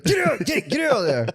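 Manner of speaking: high-pitched shooing, breaking into laughter; playful high yelp of reproach; mock scolding. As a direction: shoo a dog out of the trash. Acting out shooing someone from trash.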